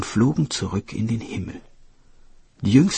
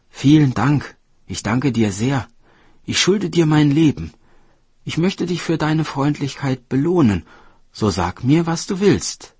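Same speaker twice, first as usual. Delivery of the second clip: higher, louder, heartfelt thanks; grateful deer; 6 semitones higher, 5 dB louder than usual. Acted. Deer thanking the woodcutter for saving its life, grateful.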